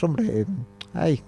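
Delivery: normal talking voice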